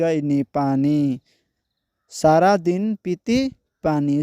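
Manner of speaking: each letter and vowel sign sounded out one at a time, then the whole word; teacher spelling out Hindi letter by letter; spelling out syllables. Shown as a demonstration. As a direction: sound out every syllable before joining it into the word. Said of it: "तो चलिए पहले उच्चारण के साथ पढ़ते हैं".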